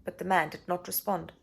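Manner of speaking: usual speaking voice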